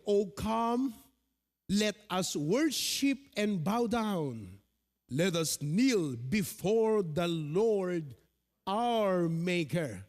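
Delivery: slow, solemn reading of the verse; measured Bible reading voice; scripture reading. Acted. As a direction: read it out like holy words, unhurried. Pastor reads the Psalm aloud.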